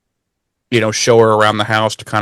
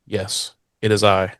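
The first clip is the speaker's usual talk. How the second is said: much softer, solemn mock reply; hushed robot Buffy; 3 semitones lower, 7 dB quieter than usual. Buffy answering Spike, soft solemn mock reply. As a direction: murmur it like a grave oracle. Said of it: "And she's like".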